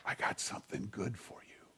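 soft unvoiced whisper, very low. Pastor voicing God whispering in the night, whispered. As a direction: breathe it like a secret promise.

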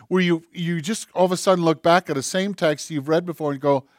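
ordinary delivery

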